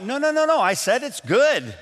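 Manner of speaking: high, rushed no no no; flustered backpedal. Retold or imitated. Answerer scrambling after offending the cook, flustered and high. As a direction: scramble to take it back. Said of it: "you just blew it".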